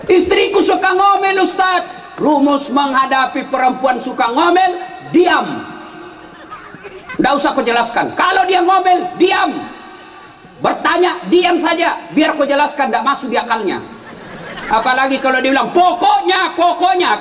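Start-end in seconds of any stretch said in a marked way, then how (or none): 0.1-1.8 a husband griping to the ustaz; complaining husband. Retold or imitated. 8.2-9.5 preacher hammering the advice; emphatic advice. Real, not acted. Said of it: "rumus ... diam". 15.8-17.2 higher insistent pokoknya pokoknya; a wife who won't hear reasons; mimicking nagging wife. Wife's stubborn insistence mimicked.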